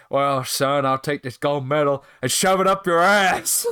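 loud mock tough-guy threat; growing into a belligerent put-on yell; wrestler's retort. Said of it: "and says".

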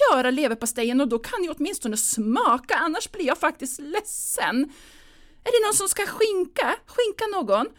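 high, loud, nervous hostess; a frantic mother pushing food on everyone; fussing mother. Acted. Mother directing the Christmas dinner: high, loud and nervous.

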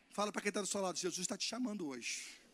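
ordinary talk, nothing marked

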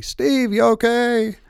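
high, loud yell across water; imitated long-distance holler; retold yelling. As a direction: yell out to a far-off swimmer. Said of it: "Jack's still yelling at me".

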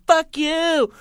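very high falsetto jeer; drawn-out, high mock yell; imitated heckling lady. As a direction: squeal it as the mimicked lady. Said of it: "she goes like".

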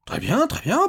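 high, loud and huffy; exasperated concession. Acted. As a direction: give in with irritation. Stranger snatches the paper back, vexed.

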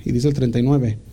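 normal talking voice

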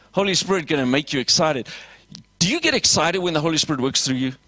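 ordinary talk, nothing marked

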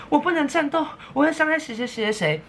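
high, whiny mock-heroine voice; mocking Sailor Moon whine. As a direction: parody her helpless refusal to fight. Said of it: "他还讲说".

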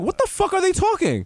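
high-pitched, baffled mock outburst; bewildered English people. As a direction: act out confused listeners, voice way up. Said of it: "all the English people was like".

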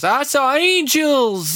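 high, slow, hammed-up Southern yokel; mock thick Southern accent, drawn out high; mock Southern bumpkin. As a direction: gush it like a wide-eyed country believer. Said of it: "really thick accents and everything".

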